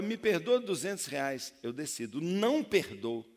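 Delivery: loud, high preaching of the colleague's refusal; shouted unforgiving debtor. Retold or imitated. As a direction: belt out his cold refusal. Unforgiving servant refuses loudly.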